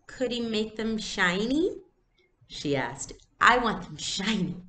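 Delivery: slow, lightly voiced child; a hint of a little girl. Little girl to her friend, hopeful.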